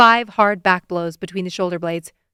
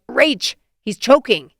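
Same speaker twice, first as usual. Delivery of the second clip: urgent yell, louder and higher; 4 semitones higher, 6 dB louder, slower than usual. Acted. T J yelling to Rach that a boy is choking, urgent yell. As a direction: shout her name across the courts in alarm. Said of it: "but T J yells".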